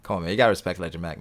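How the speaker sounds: usual speaking voice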